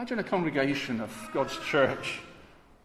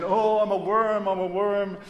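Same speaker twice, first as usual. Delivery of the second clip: high, loud whining mock lament; mock self-pity; 6 semitones higher, 5 dB louder than usual. Preacher mocking self-loathing believers, hammed-up whine. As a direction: ham up a wailing self-pitying worm. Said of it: "going round saying".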